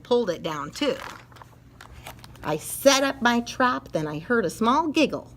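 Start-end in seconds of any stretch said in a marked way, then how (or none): none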